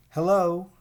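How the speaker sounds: put-on young snake greeting a sleepy one; Verdi greeting. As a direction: call out hello eagerly. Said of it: "said Verdi".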